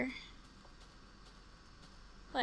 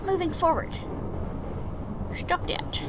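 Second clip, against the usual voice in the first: breathy hushed stop that; real tense whisper; 6 semitones higher than usual. Real, not acted. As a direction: hiss it so nothing hears. Hushed pleading at screen.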